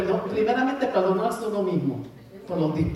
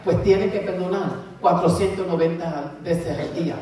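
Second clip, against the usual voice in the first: ordinary talk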